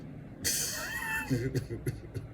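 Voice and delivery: high-pitched giggle; high squealing laugh. Real, not acted. Laughs at own joke.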